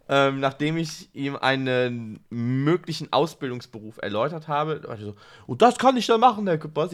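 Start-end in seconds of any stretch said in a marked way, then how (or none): 5.5-6.9 lightly voiced eager pupil, higher and quicker; retold student question, pitched up and louder; quoted pupil. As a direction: pipe up like a keen pupil.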